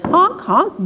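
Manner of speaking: lightly imitated high honks; goose honk. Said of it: "and it says".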